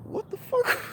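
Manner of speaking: very high, breathless, whispered squeak; high-pitched cry of disbelief; squeaky disbelief. Retold or imitated. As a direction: let it escape as a stunned squeal. Stunned reaction.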